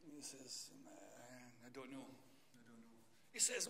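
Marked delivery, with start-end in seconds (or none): 0.9-2.9 a quiet farmer at his gate; farmer's reply. Retold or imitated. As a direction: mumble it like a farmer who won't be bothered. Taciturn farmer in joke.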